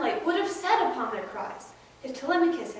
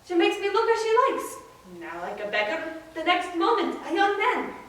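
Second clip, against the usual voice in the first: higher-pitched, emphatic fatherly persuasion; Odysseus, insistent; 4 semitones higher than usual. Acted. Odysseus assuring his astonished son of his identity, insistent.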